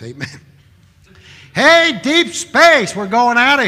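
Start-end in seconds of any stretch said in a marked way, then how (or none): 1.5-3.7 very loud, high, slow preaching cry; very loud, high, drawn-out preacher shout; rousing shout. Real, not acted. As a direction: call it out to rouse the church. Preacher calls out to congregation.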